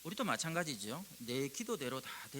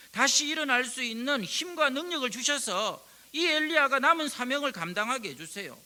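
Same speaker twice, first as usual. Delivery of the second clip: loud, high, pleading prayer building in force; impassioned intercession; 8 semitones higher, 10 dB louder than usual. Pretend. Spirit pleading for exhausted Elijah, loud high fervent prayer. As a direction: cry out to God on Elijah's behalf. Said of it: "이렇게 기도하십니다".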